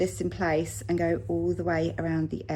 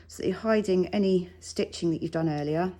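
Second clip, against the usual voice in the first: ordinary talk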